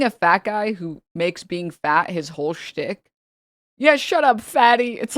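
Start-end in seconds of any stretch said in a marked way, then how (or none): 3.8-5.0 higher, louder dumb taunt; mock insult. Mocking a troll's lame insult, loud.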